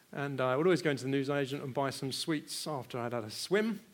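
ordinary delivery

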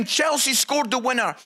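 ordinary talk

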